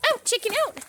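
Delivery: high shrieking shoo; shooing call. Real, not acted. Gardener shooing a chicken out loudly. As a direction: chase it out with your voice.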